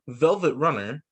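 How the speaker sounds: ordinary delivery, nothing marked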